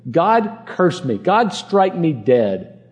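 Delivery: very slow, drawn-out curse on oneself; solemn oath. Acted. Peter invoking a curse on himself, slow and solemn. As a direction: weigh each word like swearing an oath.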